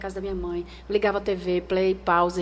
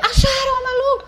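much higher, much louder excited yell; retold shout; 10 dB louder than usual. Editor-in-chief yelling the news into her earpiece, loud excited shout. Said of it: "gritaram aqui no ouvido".

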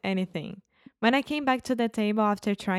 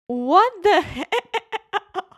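high, drawn-out mock shock; slow, laughter-broken exclamation; laughing disbelief; 12 semitones higher, 8 dB louder, much slower than usual. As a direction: imitate a stunned friend while cracking up. Acting out friend's shocked look, laughing.

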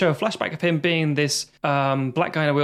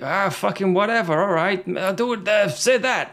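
imitating a gargled voice, pitched up; gargling character voice; 3 semitones higher than usual. Host mimics actor practising a stolen voice, gargling. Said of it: "he's gargling".

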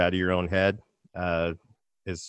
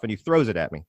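normal talking voice

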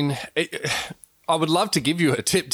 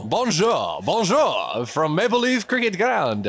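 mock French-Canadian accent, slow; 4 semitones higher, slower than usual. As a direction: ham up a French-Canadian greeting. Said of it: "that is my French-Canadian accent".

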